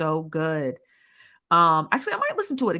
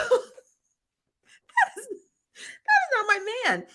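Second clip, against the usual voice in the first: high, slow laugh-crying words; crying with laughter; much slower than usual. Real, not acted. Vlogger denies a rumored boyfriend, laughing hard.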